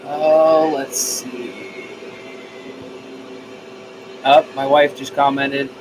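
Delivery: ordinary talk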